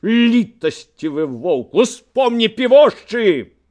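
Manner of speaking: slow taunt rising to a high yell; loud jeering shout; shouting back. A narrator's reading. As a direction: hurl the retort across. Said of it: "крычаў Корчак".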